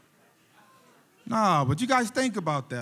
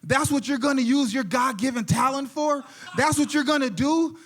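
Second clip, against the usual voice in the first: indignant father's outburst, raised pitch; higher-pitched, incredulous scolding; mad dad; 7 semitones higher than usual. Acted. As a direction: act out a dad angrily confronting his kid. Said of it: "the dad gets mad".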